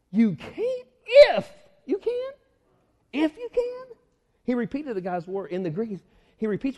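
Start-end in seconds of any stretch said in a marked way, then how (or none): 0.1-4.0 high, drawn-out disbelieving question; mock-astonished questioning; incredulous echo. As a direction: repeat it back in disbelief, pitched way up. Jesus repeats father's words, incredulous.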